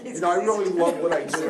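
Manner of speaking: laughing through real emotion; a small embarrassed laugh while overcome; teary laugh. Real, not acted. Lawyer telling students he loves his work, teary laugh.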